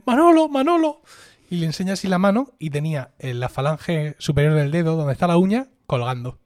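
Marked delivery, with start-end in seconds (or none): 0.0-1.0 calling out. Retold or imitated. Friend calling out, injured hand.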